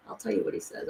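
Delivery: mild hushed tease; hushed aside. Shown as a demonstration. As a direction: drop to a confiding whisper. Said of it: "I'll tell you what he says to me".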